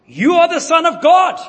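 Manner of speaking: high, loud shout; shouting demons. Retold or imitated. Demons shouting as they leave the people. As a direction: cry it out like fleeing demons. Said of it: "demons came out of many people shouting".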